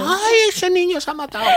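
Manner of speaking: put-on high, alarmed old woman crying out; imitating a startled old lady; panicked old lady. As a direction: squeal it like a frightened granny in the front row.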